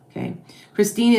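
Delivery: ordinary delivery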